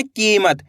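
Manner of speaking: "कीमत" with the ई held long; stretched long ई. Shown as a demonstration. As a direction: lengthen the की of "कीमत". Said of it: "खींच के पढ़ना है". ("कीमत" में ई को खींचकर)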